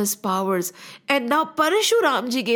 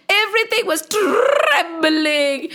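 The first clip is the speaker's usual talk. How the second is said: TREMBLING stretched out on a rolled r, high pitch; dramatic trembling; 4 semitones higher, slower than usual. A narrator's reading. Storyteller shows children the earth and heavens shaking, TREMBLING rolled and drawn out. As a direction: draw out TREMBLING with a long trilled r.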